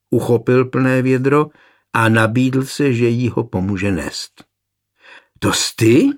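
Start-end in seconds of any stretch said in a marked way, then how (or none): 5.4-6.1 quick rising note of surprise; surprised. A narrator's reading. Girl recognises the man at the well, surprised. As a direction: blurt it out, voice jumping up at the end. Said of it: "vyhrkla překvapeně".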